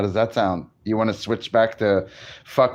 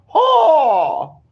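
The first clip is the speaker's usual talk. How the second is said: sharp high yelp of ho; retold loud ho; 7 dB louder than usual. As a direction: belt out a high ho. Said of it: "she was like".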